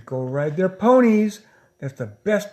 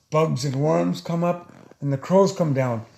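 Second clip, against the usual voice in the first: ordinary delivery, nothing marked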